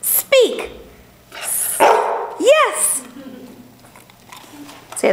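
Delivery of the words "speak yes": high-pitched command to a dog. Real, not acted. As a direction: chirp it at the dog.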